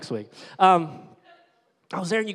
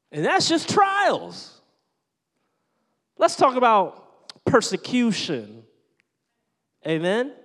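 much higher and louder, slow building call; preaching at full force; 8 semitones higher, 10 dB louder, slower than usual. Real, not acted. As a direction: lift the room, drive it home.

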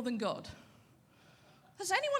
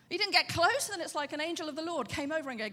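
ordinary delivery

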